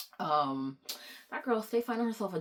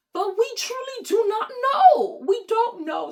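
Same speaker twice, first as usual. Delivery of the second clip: slow, loud, much higher exasperated wail; frustrated outcry; 10 dB louder, slower than usual. Real, not acted. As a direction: cry out helplessly, stretching the words. Ranting in frustration.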